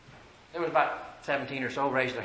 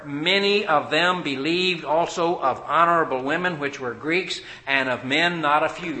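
full-force preaching; 8 dB louder than usual. Acted. Preacher urging the congregation to search scripture, loud and building. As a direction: drive the gospel home to the congregation.

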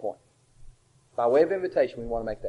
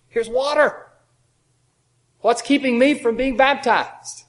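higher, louder, slow eager plea; eunuch asking; 8 semitones higher, 7 dB louder, slower than usual. Retold or imitated. The eunuch asks Philip, eager and pleading. Eunuch voiced in retold scripture.

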